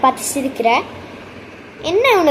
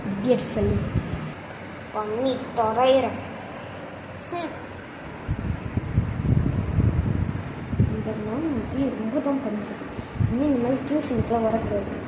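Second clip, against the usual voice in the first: softer, lower grumbling under the breath; muttered grumbling; 6 semitones lower, 11 dB quieter than usual. Acted. Student grumbling about the strict teacher, muttered. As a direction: grumble so the teacher can't hear.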